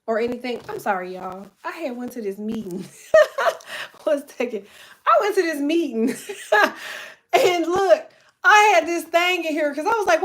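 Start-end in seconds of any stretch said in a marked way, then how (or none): 3.1-4.0 real high, loud burst of laughter; sudden high-pitched cackle; laughing. Real, not acted. Laughs mid-story. 6.2-7.1 real high-pitched laugh; short high burst of laughter. Real, not acted. Laughs retelling the meeting.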